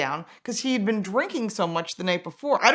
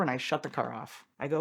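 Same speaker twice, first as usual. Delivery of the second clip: ordinary talk, nothing marked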